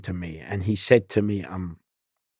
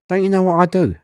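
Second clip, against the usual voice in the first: challenging; 10 semitones higher, 6 dB louder than usual. Retold or imitated. Challenging the coach.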